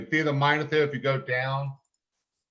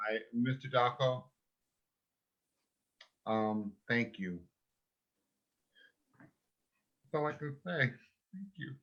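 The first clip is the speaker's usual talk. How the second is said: choked up; 9 dB quieter than usual. Real, not acted. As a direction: hold back tears as you thank him. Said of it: "I'm trying not to get emotional here".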